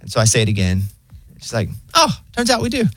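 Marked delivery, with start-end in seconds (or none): 1.9-2.8 high, breezy bot voice; unbothered robot assistant; weirdly chill AI. Retold or imitated. Pharmacy AI phone bot casually finds the record, high and chill. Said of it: "Like, it's so weirdly chill".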